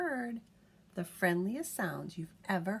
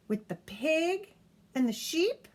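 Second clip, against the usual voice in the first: ordinary delivery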